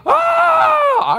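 high shriek of fright; startled scream. Retold or imitated. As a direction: let out a sudden scream. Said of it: "He went".

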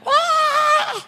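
very high shouted wail; imitated wail. Preacher reenacting her screaming on the floor, high wail. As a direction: let out a wild high wail. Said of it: "I'm a woman screaming".

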